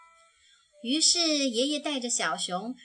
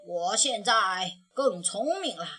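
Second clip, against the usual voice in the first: put-on old grandpa bear; 3 dB louder than usual. A narrator's reading. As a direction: speak like a calm old grandfather. (熊爷爷对小熊慢慢说，低沉温和)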